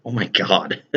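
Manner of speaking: cracking up at himself; giggly, embarrassed laugh. Real, not acted. Laughing at his own rawr.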